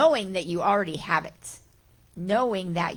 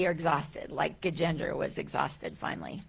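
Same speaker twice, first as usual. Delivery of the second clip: ordinary talk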